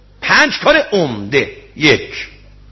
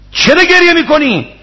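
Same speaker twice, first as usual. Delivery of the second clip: much higher, louder, pleading question; loud high cry; 14 semitones higher, 5 dB louder than usual. Acted. Question repeated, voice jumps high.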